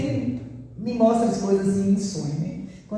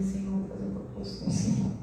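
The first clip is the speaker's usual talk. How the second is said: whispered prayer; 8 dB quieter than usual. Retold or imitated. Oração íntima relatada.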